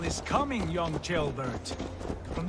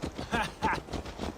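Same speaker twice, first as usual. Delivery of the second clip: chuckle; 3 semitones higher, 3 dB quieter than usual. Acted. Eivor amused by Ceolbert's thought.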